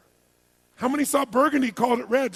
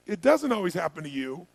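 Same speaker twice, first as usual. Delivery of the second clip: ordinary talk, nothing marked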